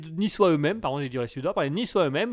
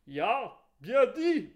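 put-on slow German accent; 3 semitones higher, 4 dB quieter, much slower than usual. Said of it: "fais un accent allemand".

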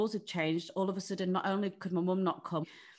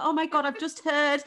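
higher, gushing well-wisher voice; mock congratulations; 8 semitones higher, 6 dB louder than usual. Friends texting the mum-to-be, higher gushing congratulations. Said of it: "People were texting me like".

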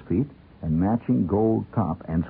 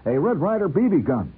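put-on high, louder kid blurt; sudden high-pitched outburst; 9 semitones higher, 4 dB louder than usual. A narrator's reading. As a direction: blurt it before you can stop yourself. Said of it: "I heard myself blurt".

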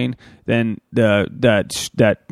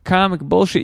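higher mock listener voice; heckling listener; 6 semitones higher than usual. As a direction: scoff like a doubting fan. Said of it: "you might be like".

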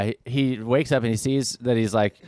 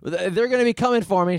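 mock panic; 11 semitones higher, faster than usual. Panicked soldier voiced.

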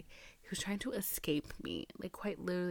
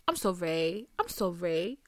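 usual speaking voice

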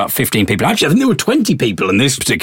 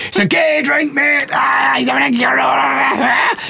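much higher, shouted mocking lad, slow at the end; jeering stag. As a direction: bellow it like the stag lad showing off to his mates. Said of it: "He's like".

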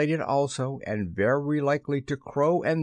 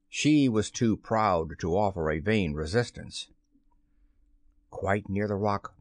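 ordinary delivery, nothing marked

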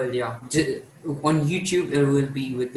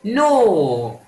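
shocked denial; 6 semitones higher, 6 dB louder than usual. Real, not acted.

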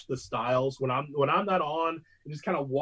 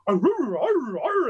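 dog barks, rising higher and louder; mock barking; 8 semitones higher, 6 dB louder, much slower than usual. Host imitating the Stews barking on air, loud mock barks. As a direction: bark like a dog show host. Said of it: "the dogs thing going, and they're barking".

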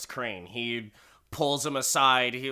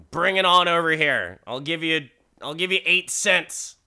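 mock adult ordering a kid; 4 semitones higher, 6 dB louder than usual. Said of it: "you're just like".

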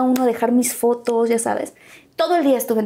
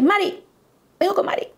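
higher, innocent bluff; higher, put-on confident answer; 8 semitones higher than usual. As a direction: sound sure while making it up. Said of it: "Y entonces dije".

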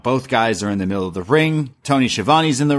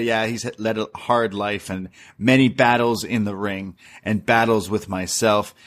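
ordinary talk

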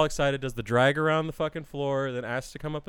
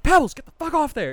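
much higher and louder, scolding shout; retold yell; 11 semitones higher, 7 dB louder than usual. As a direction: yell at the dog on the desk. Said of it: "and I yelled at her".

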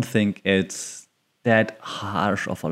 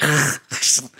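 harsh throat-scraping German rasp; mock guttural throat noise; fake movie German; 4 semitones higher than usual. Shown as a demonstration. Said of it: "not like in American movies".

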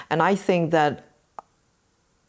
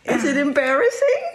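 playful high teasing; 10 semitones higher, 4 dB louder than usual. Real, not acted. Mother asks laughing son in video, high and teasing.

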